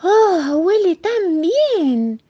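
high, loud, long-drawn mouse rapture; delighted savouring. Acted. Little mouse sniffs the piece of moon, delighted savouring.

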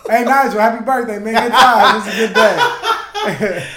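loud, high, laughing birthday greeting; jovial shout-out. Real, not acted. Wishing a friend happy birthday on air, jovial shout. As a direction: call it out big with laughter in the voice.

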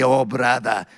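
low imitated Maltese accent. Sleepy Maltese friend answers the preacher, low Maltese accent. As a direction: put on a Maltese accent, pitched right down. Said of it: "that's my Maltese accent".

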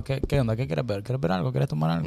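voz muy bajita imitándose a sí mismo serio; serio, retraído. Retold or imitated. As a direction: pregunta con disimulo, casi sin volumen. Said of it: "y yo, serio". (serio y bajito)

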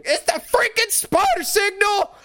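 very high, loud excited squeal; thrilled squeal. Retold or imitated. Fan imitating Peter spotting the spider signal, squealing. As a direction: squeal with pure delight.

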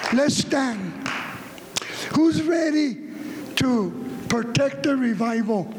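ordinary talk, nothing marked

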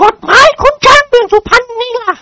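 high, furious lady retort; shaking with anger. A narrator's reading. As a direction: spit it out trembling with rage. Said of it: "โกรธจนตัวสั่น". (โกรธจนตัวสั่น)

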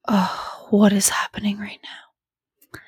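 hushed, slow, breathless whisper to herself; worried whisper. Retold or imitated. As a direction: whisper it as if something is wrong. Retold panic alone in the car.

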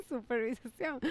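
"supervisación" through suppressed laughter, slow; laughing. Real, not acted. Friend repeats her slip, giggling. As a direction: giggle out the slipped word "supervisación".

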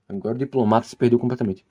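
usual speaking voice

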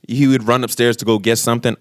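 usual speaking voice

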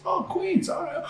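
high, soft female impression; softly mimicked woman's reply; imitated club girl. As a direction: lift it high and light, imitating her.

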